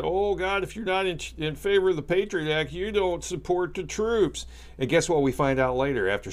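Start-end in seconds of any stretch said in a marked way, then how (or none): 0.0-4.5 higher, mock-pleading politician voice; mocking Congress. Congress scolding anyone who opposed the Patriot Act, mocking higher voice. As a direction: ham up a pious congressman scolding the public. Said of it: "and they said".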